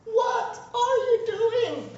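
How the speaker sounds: very high, slow, drawn-out plea; pleading God. Retold or imitated. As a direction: beg them like a heartbroken father. He looks at his people and says.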